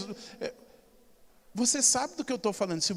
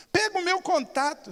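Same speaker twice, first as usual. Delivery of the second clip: put-on insistent old lady; 8 semitones higher than usual.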